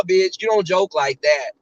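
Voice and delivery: normal talking voice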